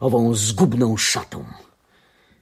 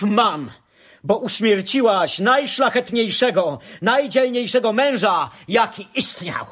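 bitter accusation; 7 semitones higher than usual. Acted. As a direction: condemn her, climbing in pitch as you call on the gods. Son accusing mother of murder.

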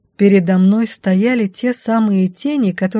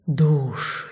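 low shadow voice; 4 semitones lower, 4 dB quieter than usual. A narrator's reading. Shadows answer.

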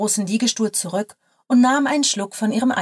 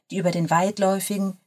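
ordinary delivery

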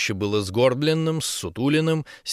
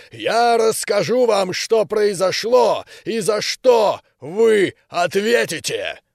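loud, slow, higher commanding giant general; booming giant voice; 7 semitones higher, 4 dB louder than usual. A narrator's reading. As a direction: boom each phrase like a hammer on an anvil. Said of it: "звучало как стук молота по наковальне".

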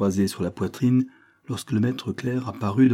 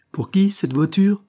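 high, loud startled question; sharp demand; 11 semitones higher, 6 dB louder, slower than usual. A narrator's reading. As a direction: demand to know at once. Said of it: "demanda-t-elle vivement". (question vive et aiguë)

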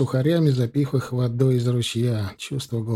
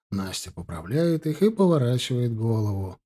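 plain reading voice

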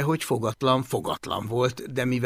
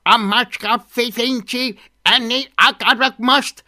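high, loud stuttering tantrum; howling dragon; 9 semitones higher, 6 dB louder than usual. A narrator's reading. Wriggling dragon demands food, howling stubbornly. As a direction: stammer and yell like a greedy little dragon. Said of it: "és közben üvöltött".

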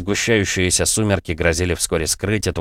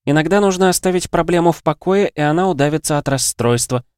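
high-pitched playful quip; light joking character voice; 9 semitones higher than usual. A narrator's reading. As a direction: toss it off as a joke, pitched high. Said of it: "шутила Лера".

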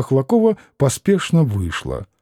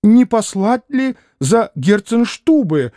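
higher, slowed anxious exclamation; exclaiming; 8 semitones higher, slower than usual. A narrator's reading. Mother suggesting the doctor, mildly exclaiming. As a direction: blurt out the idea. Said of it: "воскликнула было госпожа Хохлакова".

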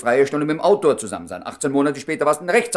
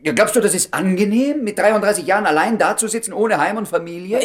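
bitter reproach; 6 semitones higher, 5 dB louder than usual. Acted.

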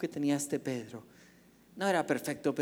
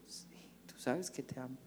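much softer, lower reply; humble answer; 3 semitones lower, 12 dB quieter than usual. Acted. Peter quietly answers Jesus' question, soft and low. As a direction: answer gently, almost under the breath.

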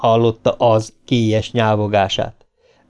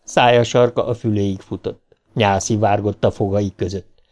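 plain reading voice

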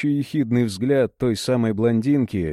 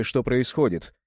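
ordinary delivery

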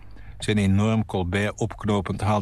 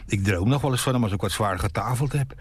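usual speaking voice

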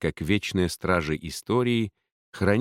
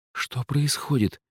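alarmed exclamation; 6 semitones higher than usual. Acted. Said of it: "воскликнула колдунья".

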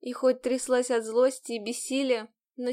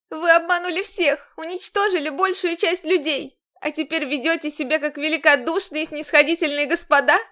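loud, higher bitter accusation; angry accusation; 5 semitones higher, 6 dB louder than usual. Acted. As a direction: throw it in his face. Heroine accusing the commander.

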